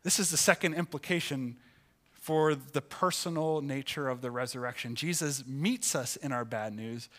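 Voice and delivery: ordinary talk, nothing marked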